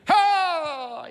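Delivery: sudden high, loud "¡ah!" as the cart is sent off; loud high shout. Retold or imitated. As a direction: cry out to send it away. Sending off the cart. (grito agudo y fuerte al despachar el carro)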